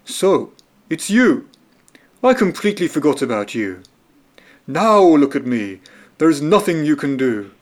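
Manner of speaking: a hint of a gloomy trapped lion; trapped lion dismissing the little mouse; lightly voiced weary lion. A narrator's reading. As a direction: sigh it like a big cat in a net.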